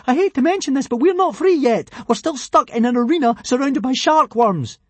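high, loud, anxious; panicked. A narrator's reading. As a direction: blurt it out in alarm. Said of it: "shrieked Fishlegs".

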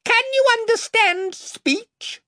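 very high, slow, shrill question. A narrator's reading. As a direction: squeak it out shrilly. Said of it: "came his shrill voice".

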